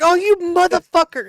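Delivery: high, loud mock outrage; outraged guy. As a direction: squeal it like the prank victim. Retold guy's outraged reaction.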